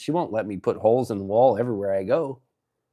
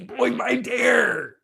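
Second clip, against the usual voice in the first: much higher, slow drawn-out shout; hype shout; 12 semitones higher, much slower than usual. Real, not acted. Streamer admiring fresh coffee, slow drawn-out hype shout. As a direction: stretch it out in a big proud yell.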